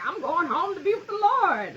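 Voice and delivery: high, lofty imitation of mom; talking all big. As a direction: playfully mimic mom's grand talk. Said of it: "talking all big".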